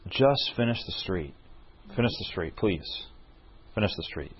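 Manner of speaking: soft, gentle urging, fading away; gentle inner voice. Retold or imitated. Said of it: "the Lord impresses me in my heart".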